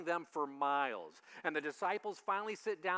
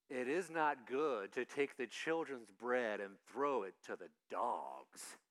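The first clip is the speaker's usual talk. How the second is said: stern Jesus; 8 semitones lower, 3 dB quieter, slower than usual. Acted. Voicing Jesus' harsh reply.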